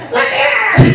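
small son begging mom to let him fight; child voice. Retold or imitated. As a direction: play a fired-up little boy held back. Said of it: "Mom, let me at him!".

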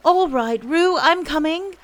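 put-on high, loud boyish shout; calling out. A narrator's reading. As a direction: call across to someone in trouble. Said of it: "called Christopher Robin".

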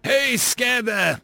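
slow, high call over the noise. Acted. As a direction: shout across the loud dropship. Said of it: "called him over to open conlink".

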